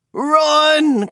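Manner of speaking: high, loud shouted warning; frantic cry. A narrator's reading. Darrington to the others, a loud high cry. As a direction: yell to get them away. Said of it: "cried Darrington".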